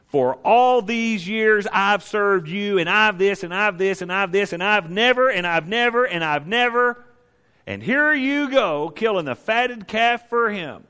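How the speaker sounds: high, slow, aggrieved complaint building on each never; resentful older brother. Retold or imitated. Older brother complaining to his father about the fatted calf, high aggrieved voice. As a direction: whine it out like a wronged son listing his grievances. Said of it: "what does that older brother do?".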